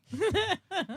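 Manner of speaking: sudden high cackle; real laugh; high burst of laughter. Real, not acted. Laughs at chat message.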